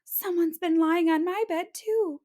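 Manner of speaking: high mother bear, stress on my; Mama Bear finding her bed slept in. Acted.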